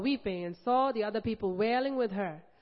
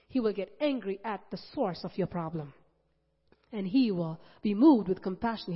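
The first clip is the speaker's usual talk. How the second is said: ordinary delivery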